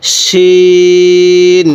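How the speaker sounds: শিন held very long with a full thick sh; thick shin. Shown as a demonstration. Teacher models Arabic shin, thick and very slow. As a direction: draw out শিন heavy and thick. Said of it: "আপনি আমার সাথে পড়ুন".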